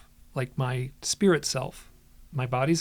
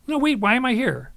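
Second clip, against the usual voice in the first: raised, higher retold protest; insistent questioning; 10 semitones higher, 5 dB louder than usual. Narrator retelling his challenge to the beings, insistent. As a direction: push the question harder, louder and higher.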